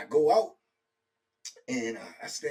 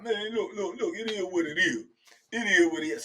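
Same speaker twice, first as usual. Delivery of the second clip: mocking cellmate voice, pitched up; retold cellmate; 7 semitones higher, 6 dB louder than usual. Retelling cellmate telling him to accept it. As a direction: play the other guy shrugging it off. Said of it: "he was like".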